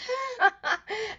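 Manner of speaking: a squealing, giggling laugh; high giggle. Real, not acted.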